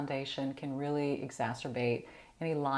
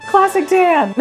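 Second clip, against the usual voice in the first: much higher, loud, through laughter; laughing shout; 21 dB louder, slower than usual. Real, not acted. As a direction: blurt it out cracking up. Laughing at her shade.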